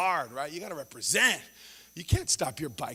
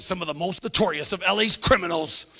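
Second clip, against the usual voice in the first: louder mock news voice, drawn-out ending; exaggerating newscaster; 3 semitones higher, 8 dB louder, slower than usual. Newscaster hyping local criminals to viewers, exaggerated announcer. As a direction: sensational anchor stretching the last word. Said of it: "you listen to the news".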